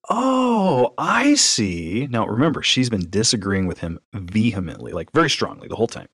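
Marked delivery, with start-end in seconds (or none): 0.1-2.1 mock realization. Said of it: "he turns to her and he says".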